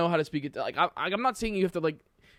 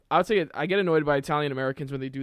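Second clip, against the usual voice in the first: ordinary delivery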